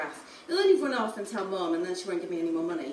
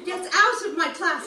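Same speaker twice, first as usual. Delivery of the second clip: shouting teacher; 9 semitones higher, 9 dB louder than usual. Acted. Teacher throwing student out.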